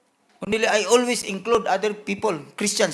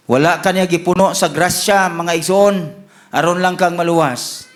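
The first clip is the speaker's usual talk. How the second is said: forceful exhortation; 2 semitones lower, 5 dB louder than usual. Real, not acted. Preacher tells congregation God has entrusted them, exhorting forcefully. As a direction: drive it home to the congregation.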